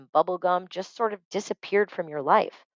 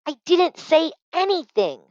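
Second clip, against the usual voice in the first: screeching; 12 semitones higher than usual. Acted. Sister protesting to her mother, high screeching. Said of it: "Zippernose screeched".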